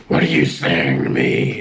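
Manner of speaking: low, creepy possessed voice; creepiest voice. Retold or imitated. Brother answering dad's prayer in a low creepy voice. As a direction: drop low and sinister like something else is talking. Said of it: "in the creepiest voice ever".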